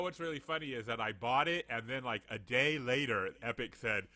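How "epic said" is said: ordinary talk, nothing marked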